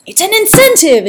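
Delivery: high, loud triumphant shout; shouting to the heavens. A narrator's reading. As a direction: proclaim it to the sky. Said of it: "Ezekiel shouted to the heavens".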